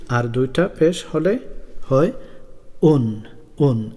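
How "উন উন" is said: উন stretched very slowly, n held; tanween un sound. Shown as a demonstration. Teacher slowly shows children the double-pesh sound. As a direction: draw out উন, closing on the n. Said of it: "এভাবে".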